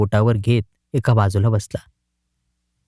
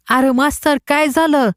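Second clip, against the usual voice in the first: put-on much higher boy's voice with a rise on मास्तर; worried boy; 11 semitones higher than usual. A narrator's reading. The boys ask their crying friend what happened, high.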